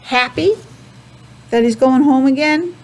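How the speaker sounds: ordinary delivery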